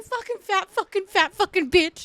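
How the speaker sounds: mock angry mom. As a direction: mimic mom snapping at her sister. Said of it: "she'd get all mad at you".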